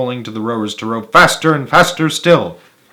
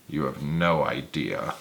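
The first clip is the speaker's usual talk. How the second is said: put-on low, slow, much softer old man; old Grandpa Joe; 9 semitones lower, 10 dB quieter, slower than usual. A narrator's reading. Grandpa Joe answering Wonka, low weary old voice. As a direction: sigh it out as a tired old man. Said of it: "said Grandpa Joe".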